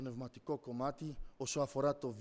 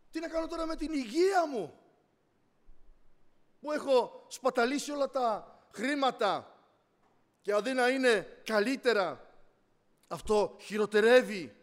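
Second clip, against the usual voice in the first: raised, acted-out wail of complaint; despair; 8 semitones higher, 11 dB louder than usual. Sick person lamenting health and wasted money, acted despair. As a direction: lament it loudly, starting high. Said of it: "στην απελπισία του".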